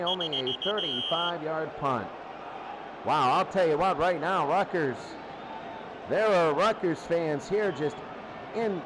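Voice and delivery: ordinary talk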